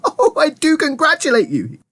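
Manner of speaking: high, gushing congratulations; overjoyed high-pitched cry; excited cry. A narrator's reading. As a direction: gush it high with delight. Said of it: "he cried".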